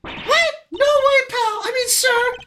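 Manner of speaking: very high, loud cartoon squeal of protest; startled witness character. Witness told of a flaw in the story, startled squeal.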